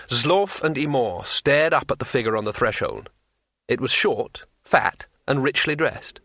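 plain reading voice

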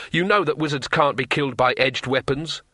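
plain reading voice

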